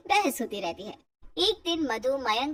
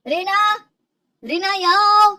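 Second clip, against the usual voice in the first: loud call across the house, higher; shouting her name; 7 semitones higher, 11 dB louder than usual. Acted. Husband loudly calling his wife into the room. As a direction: bellow for her to come. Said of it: "ये क्यों इतने जोर-जोर से चिल्ला रहे हो?".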